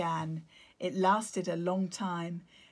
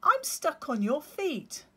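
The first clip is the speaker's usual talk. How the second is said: little chick; 9 semitones higher, 6 dB louder than usual. Acted. Otto voiced.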